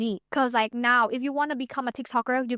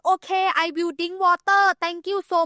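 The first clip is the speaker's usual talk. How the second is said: mock beginner English; 6 semitones higher, 3 dB louder than usual. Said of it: "even though I speak English, like".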